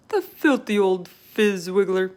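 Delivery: loud, slow giant's outburst; shouting. Acted. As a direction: bellow the insult slowly and loud. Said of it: "shouted the B F G".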